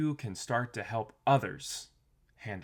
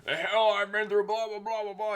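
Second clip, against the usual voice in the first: high, louder mock outburst; angry retort; 11 semitones higher, 4 dB louder than usual. Stranded partner snapping back at the spouse, in a high mock outburst. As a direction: snap back in exaggerated anger. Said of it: "You just get angry".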